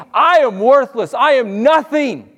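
ordinary talk, nothing marked

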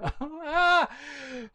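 high, drawn-out panicky cry; panicked gasp. Acted. Johnny freaking out after hitting the duck, panicked gasp. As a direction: gasp in mock horror.

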